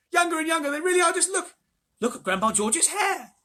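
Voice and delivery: put-on high, loud thrilled boy; boy crying out in delight; excited cry. A narrator's reading. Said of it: "cried Charlie".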